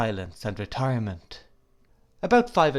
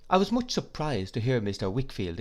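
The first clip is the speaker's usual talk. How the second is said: ordinary delivery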